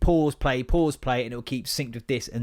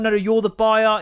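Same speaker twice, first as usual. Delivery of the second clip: louder, higher correcting; mock market trader; hammed-up dad; imitating the dad; 8 semitones higher, 7 dB louder than usual. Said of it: "they talk exactly the same".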